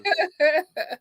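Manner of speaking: trailing giggly laughter; laughing. Real, not acted. Host still amused by friend's joke, trailing laughter. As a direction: keep chuckling as the joke settles.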